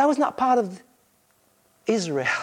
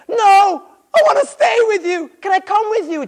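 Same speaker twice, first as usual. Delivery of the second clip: high, loud desperate begging; pleading cry; 13 semitones higher, 10 dB louder than usual. Acted. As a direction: beg him not to leave you, voice breaking high. Healed man begging Jesus.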